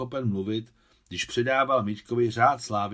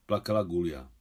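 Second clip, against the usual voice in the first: plain reading voice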